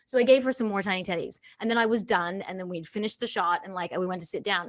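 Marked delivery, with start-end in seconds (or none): none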